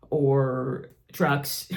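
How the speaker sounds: ordinary delivery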